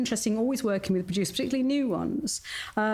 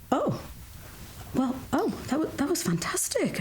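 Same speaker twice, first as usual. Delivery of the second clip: higher, hesitant mock amazement; retold amazed director; 5 semitones higher than usual. Director after silence following her read, mock amazed. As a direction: imitate a director taken aback by a read. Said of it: "And they go".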